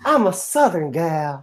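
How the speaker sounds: high-pitched mock Southern drawl; put-on high Texan gal accent. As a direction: echo a Southern gal's twang. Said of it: "the South country".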